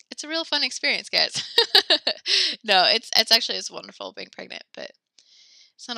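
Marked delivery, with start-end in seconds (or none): 0.1-1.4 higher-pitched, breaking into a laugh; wry joking. Real, not acted. Joking about pregnancy discomfort. 1.5-2.6 loud, high burst of laughter; real laugh. Real, not acted. Laughs at own pregnancy joke.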